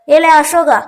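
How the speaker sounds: grandfather calling out loud to his grandson; urgent call. A narrator's reading. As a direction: call him back sharply before he leaves. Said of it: "என்று வேகமாய் அழைக்க".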